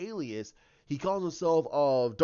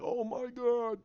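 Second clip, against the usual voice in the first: high, breathless "Oh my God"; a jolt of horror; shocked gasp; 8 semitones higher, slower than usual. Retold or imitated. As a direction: Luke frozen in dread, high and startled. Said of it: "He's like".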